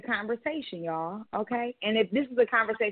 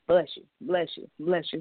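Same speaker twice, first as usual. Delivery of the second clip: lower, weary rote delivery; dutiful repeated courtesy; 5 semitones lower than usual. Retold or imitated. Blessing coworkers who never thank her, dull and rote.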